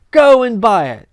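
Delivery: loud urgent call; eager command. Acted. Scrooge ordering the boy to buy the turkey, loud. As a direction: send the boy off.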